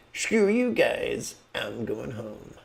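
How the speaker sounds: mock Cartman's huff; Cartman impression. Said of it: "Cartman on South Park".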